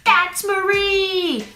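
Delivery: calling out Marie. Shown as a demonstration.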